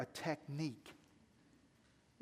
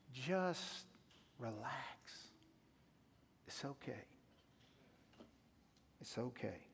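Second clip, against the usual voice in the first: much softer, lower reassurance; calm them down gently and quietly; soothing; 5 semitones lower, 10 dB quieter, slower than usual. Real, not acted. Pastor reassuring nervous new Christians, soft and gentle.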